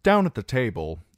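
usual speaking voice

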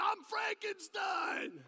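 very high, slow, loud bellow; yelled introduction. Retold or imitated. Drunk throwing his arm round a newcomer, yelling. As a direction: holler it, stretching the name out. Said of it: "he yells in my ears".

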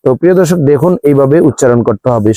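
usual speaking voice

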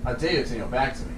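lightly voiced quiet imperial challenge; Gladiator quote. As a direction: recite the emperor's challenge low and hushed. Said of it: "My name is Maximus Decimus".

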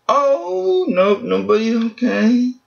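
high, quick letdown on a bad pull; disappointed surprise. Real, not acted. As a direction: squeak it out as the hope dies. Disappointed pack reveal.

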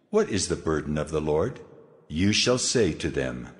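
plain reading voice

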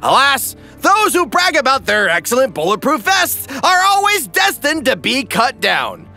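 ordinary delivery

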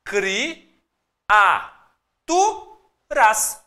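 "Cri, a, tu, ras" split into separate syllables with pauses. Shown as a demonstration. Teacher splitting the word for the class, syllable by syllable. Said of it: "ele fala pra nós criaturas".

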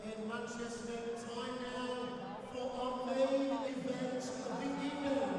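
booming ring announcer build to the name. Real, not acted. Ring announcer to the arena crowd, building and booming.

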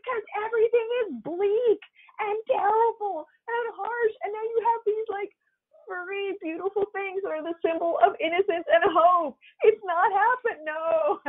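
high-pitched comic wail; exasperated rant. Retold or imitated. Wife explaining the bleak show to husband, exasperated. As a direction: plead your case in hammed-up despair.

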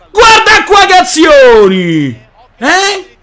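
slow loud exultant shout. Real, not acted. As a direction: belt it in triumph. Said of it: "repeated louder".

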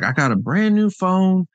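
high-voiced imitation of a puzzled old lady; nice old lady. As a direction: pitch it well up, imitating an older woman. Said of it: "she's like".

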